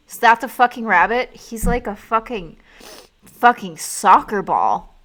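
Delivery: slow, loud stunned outburst; shocked yell. Real, not acted. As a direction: blurt it out in disbelief at the size. Shocked at the giant rabbit.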